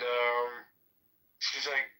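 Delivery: normal talking voice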